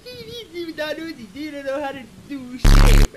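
loud, slow, high mocking commenter; hammed-up hater taunt. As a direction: sneer it like a troll in the comments. Imagined commenter mocking him.

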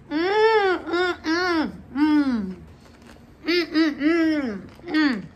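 high, drawn-out mm sounds of pleasure; savoring hums. Real, not acted. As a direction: hum with delight at each bite. Tasting the food on camera.

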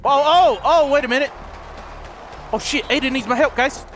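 ordinary talk

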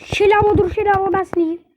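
loud playful plea; shouted plea. Real, not acted. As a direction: yell at the enemy to hold on. Calls to enemy Shelly twice.

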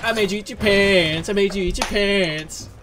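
high, loud sing-song gloating; Cartman. Retold or imitated. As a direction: chant it as a gleeful taunt. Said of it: "And he's like".

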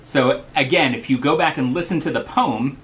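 usual speaking voice

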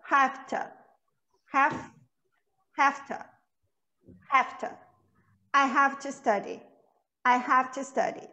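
I have to study as HAF-tuh, quick and linked. Shown as a demonstration. Teacher modeling I have to study at speed. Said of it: "porque a gente tá indo mais rápido".

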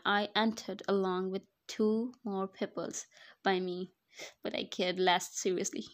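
ordinary talk, nothing marked

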